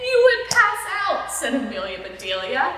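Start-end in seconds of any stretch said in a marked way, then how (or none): 0.1-1.3 high playful punchline; high squeaky voice. Acted. Amelia punchline.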